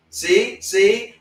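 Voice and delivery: high, loud, needling imitation; imitating smug friend. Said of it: "all he do is like".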